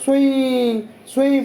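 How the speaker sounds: slow whooshing सुई सुई like wind; teacher imitates the wind blowing; imitating wind. Shown as a demonstration. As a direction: blow the सुई सुई out slowly. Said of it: "उसको बोलते हैं सुई".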